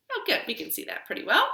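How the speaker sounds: plain reading voice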